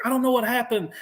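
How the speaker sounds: high-pitched imitation of a shook-up Marine; mock rattled, pitched well up; shaken. Shook-up engineer reporting to his team leader, imitated as rattled. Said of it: "He's shook the fuck up".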